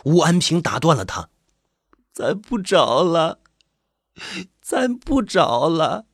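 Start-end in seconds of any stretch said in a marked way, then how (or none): 2.1-6.1 high, slow, tearful pleading; drawn-out weeping wail, climbing higher; crying harder. A narrator's reading. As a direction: beg him through mounting sobs. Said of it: "李早哭得更厉害了".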